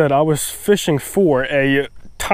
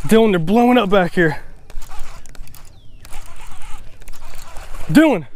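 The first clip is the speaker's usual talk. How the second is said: calling out; 5 semitones higher than usual. Real, not acted. Calling to friend across the bank.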